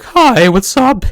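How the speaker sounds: high, loud, drawn-out greeting; mock heartthrob introducing himself in a squeaky-high loud voice; exaggerated high-pitched show-off hello; super handsome guy. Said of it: "super handsome guy, he could go on there".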